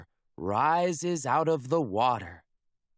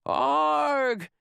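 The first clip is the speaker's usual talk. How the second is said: high, loud pirate yell; crew yell; 13 semitones higher, 6 dB louder than usual. Acted. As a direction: bellow a startled pirate arg. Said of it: "yells the crew".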